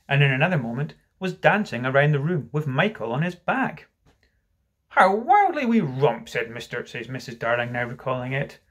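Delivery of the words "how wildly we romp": high, slow, louder exclamation; wistful mother. Acted. Missus Darling recalls the romp, high and drawn out.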